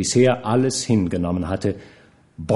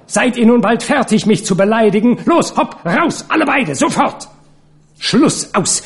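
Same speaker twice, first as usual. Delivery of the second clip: very high-pitched furious shouting; screaming; 11 semitones higher, 4 dB louder than usual. A narrator's reading. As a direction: drive them both out with shrill orders. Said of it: "sie schrie noch lauter".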